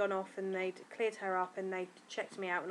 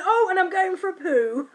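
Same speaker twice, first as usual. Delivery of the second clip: higher, louder cheery blurt; cheerful announcement; 10 semitones higher, 12 dB louder than usual. Retold or imitated. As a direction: announce it brightly. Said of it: "And I went".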